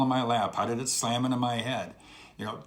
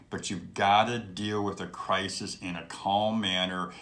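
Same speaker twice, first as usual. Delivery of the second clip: usual speaking voice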